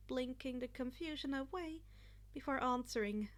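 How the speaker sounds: ordinary delivery, nothing marked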